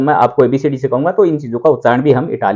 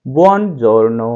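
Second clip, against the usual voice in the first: बुongiorno drawn out very slowly; repeat-after-me drill; slow model word; much slower than usual. Shown as a demonstration. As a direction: stretch बुongiorno for students to copy. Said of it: "बार बार मेरे साथ में बोल करके".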